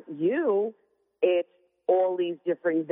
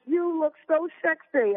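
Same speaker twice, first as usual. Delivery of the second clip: mock husband; 12 semitones higher than usual. Wife teasing her husband's compliment in a high mock voice. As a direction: send up his compliment, playfully. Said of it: "He says".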